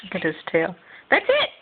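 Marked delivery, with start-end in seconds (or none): 1.1-1.5 high, sing-song pet talk; talking to a dog. Real, not acted. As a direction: coo at the dog brightly. Birthday party with the dog.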